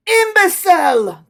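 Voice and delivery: raging cry. Acted. Napoleon rages at the insolent tailor, furious cry. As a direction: explode with rage. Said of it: "cried Napoleon, beside himself with rage".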